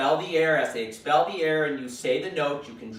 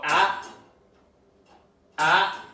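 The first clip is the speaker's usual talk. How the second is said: warning ah ah; 3 dB louder than usual. Shown as a demonstration. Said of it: "you just go".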